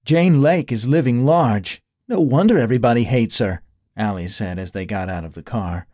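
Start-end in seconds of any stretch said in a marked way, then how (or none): 0.0-3.6 lightly voiced young woman, a touch higher; pushy partner needing rent money; Allie's voice. A hint of Allie.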